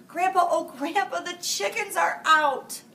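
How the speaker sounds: high, loud urgent call across the farm; a kid shouting the news, high and loud; calling child. Acted. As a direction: call out to Grandpa in a panic. Grandkids calling out to Grandpa.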